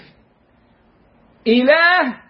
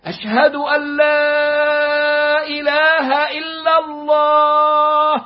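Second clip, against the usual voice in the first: slow, solemn sermon reading, الله held; sermon delivery; slower than usual. Shown as a demonstration. Teacher to students, declaiming as in the sermon. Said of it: "طب في الخطبة".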